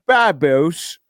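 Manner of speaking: one word in put-on British; mock British accent. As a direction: toss it out in a playful British accent. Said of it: "I literally love the accent".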